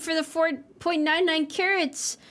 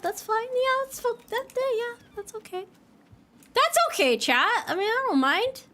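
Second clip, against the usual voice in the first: high-pitched hurt voice; 9 semitones higher than usual. Real, not acted. Hurt by chat, squeaky.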